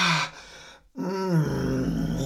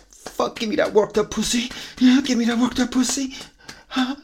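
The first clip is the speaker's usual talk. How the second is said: higher and louder, pressing and urgent; urgent, rising excitement; 9 semitones higher, 7 dB louder than usual. Real, not acted. Lover nearing climax, loud and urgent. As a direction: call out, building to a peak.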